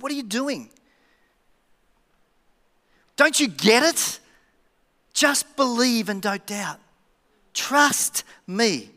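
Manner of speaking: really firm. Acted. Jesus correcting his doubting disciples, speaking firmly. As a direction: press each phrase home slowly. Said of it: "he spoke really firmly".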